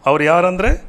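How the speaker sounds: loud, high, stretched-out preaching; rousing scripture proclamation. Acted. Preacher shows viewers the great multitude in heaven, rousing proclamation. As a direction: punch each phrase out with rising endings.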